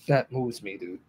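real, soft and low, choked up; fighting back tears, voice dropping away; moved to tears. Real, not acted. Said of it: "Not supposed to be crying".

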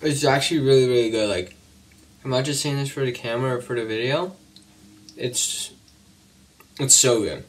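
talking with food in mouth; chewing while talking. Real, not acted. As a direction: mouth partly full. Seen 1: Bites at -3, then jaw works and lips stay tight while talking.

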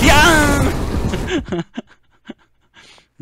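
very high, long drawn squeal; hammed-up cry of dismay; mock squeal. Real, not acted. やられてふざけた悲鳴を上げる.

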